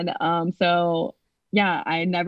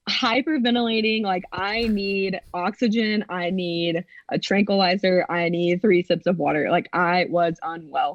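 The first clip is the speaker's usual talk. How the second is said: ordinary delivery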